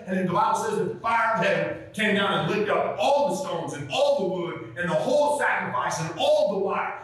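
usual speaking voice

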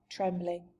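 plain reading voice

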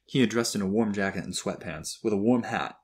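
plain reading voice

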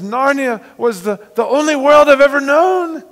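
high, loud wail of a grieving unicorn. Acted. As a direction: cry it out as the heartbroken unicorn. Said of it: "And he says".

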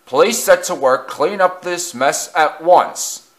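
stern order. A narrator's reading. Director orders Henry to clear the mess, stern. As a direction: give a firm, measured order.

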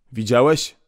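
ordinary delivery, nothing marked

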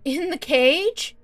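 terrified shouting as the cage comes; panicked protest. Acted. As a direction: cry out in rising panic. Captive panics about being moved.

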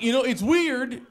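imitated bewildered roommate; puzzled roommate. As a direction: puzzle aloud through the door. Roommate puzzles through door.

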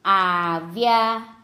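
આવ્યા very slowly, in two long syllables; slow word demonstration. Shown as a demonstration. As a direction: stretch out આવ્યા for learners. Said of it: "જોડણી પર ધ્યાન આપજો".

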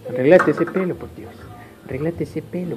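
ordinary delivery

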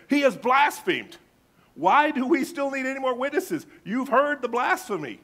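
high, loud outraged cry of blasphemy; outraged accusation. Acted. High priest to the council, outraged loud accusation. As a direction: thunder the charge at the council, scandalised.

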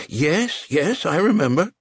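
high, eager yes; frantic agreement. Acted. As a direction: scramble to agree.

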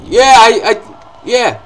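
loud, high, stumbling cheer; triumphant shouting. Real, not acted. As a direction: cheer over a sudden win. Succeeds at catching bullet.

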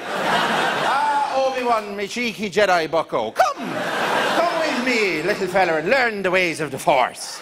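high, slow, lilting Irish Jedi; Irish accent. Retold or imitated. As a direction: play Liam Neeson as a cheeky Irishman. Qui-Gon voiced as Irish.

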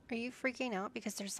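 usual speaking voice

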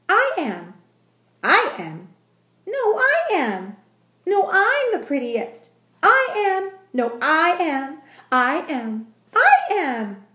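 high, loud bickering kittens; squabbling cat voices. A narrator's reading. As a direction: argue like a crowd of cats. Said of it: "cried hundreds ... of voices".

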